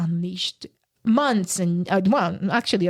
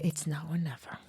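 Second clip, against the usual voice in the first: much softer, a low private vow; hushed resolve; 5 semitones lower, 12 dB quieter than usual. Retold or imitated. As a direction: say it under your breath to yourself. Deciding to flee at the airport.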